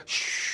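imitated spray can hiss, unvoiced; hairspray hiss. Imitating the salon hairspray gun for the listener, unvoiced hiss.

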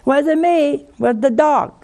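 put-on high, loud innocent child; lying child. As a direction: insist you are innocent, too high. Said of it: "the thing you should not do is to deny it".

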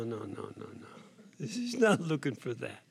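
ordinary delivery

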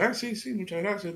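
imitating the waiter's pleased thanks; lightly voiced waiter. Waiter thanks the customer, mildly mimicked high.

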